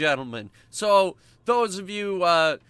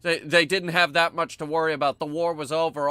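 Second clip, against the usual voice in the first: usual speaking voice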